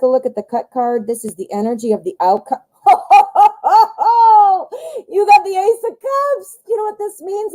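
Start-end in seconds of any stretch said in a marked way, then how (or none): 2.8-5.0 a high, bright burst of real laughter at the card; delighted laugh. Real, not acted. Reader laughing out loud at the card reveal. 5.1-7.5 excited. Real, not acted. Excited card reveal to viewer.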